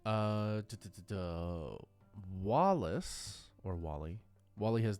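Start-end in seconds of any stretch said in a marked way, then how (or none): none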